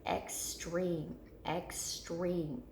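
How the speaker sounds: extreme slowly split as ex, then treme; "extreme" carefully broken for students to echo; sounding out syllables. Shown as a demonstration. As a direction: say extreme in two separate beats with a long E. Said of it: "I want you to say it with me".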